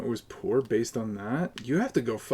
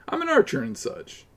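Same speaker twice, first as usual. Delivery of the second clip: much higher, mocking; mock silly voice. Streamer mocking a player called Bing Bong, high silly voice. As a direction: squeak it like a goofy character.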